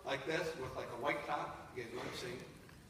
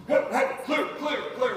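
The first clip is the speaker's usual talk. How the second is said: loud, higher barked calls; swat team calls; 6 semitones higher, 7 dB louder, slower than usual. Retold or imitated. Swat officers clear a room, loud calls.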